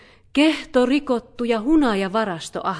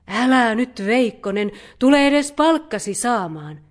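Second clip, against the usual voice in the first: raised, louder voice, urging; coaxing request; 3 semitones higher, 7 dB louder than usual. A narrator's reading. Mother bear asking the fox to come for its pay, coaxing. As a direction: plead lightly, trying to coax someone back. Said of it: "pyysi karhumuori".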